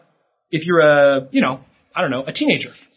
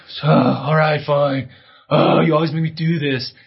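put-on grudging, complaining kid voice; sulky kid. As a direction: grumble it like a sulky child. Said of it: "You're not angry".

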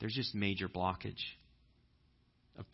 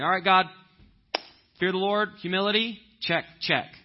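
mock checklist; 8 semitones higher, 9 dB louder than usual.

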